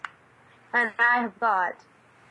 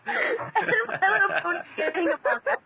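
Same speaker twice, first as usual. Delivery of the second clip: laughing; 11 semitones higher than usual. Real, not acted. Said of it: "laughing at pony news".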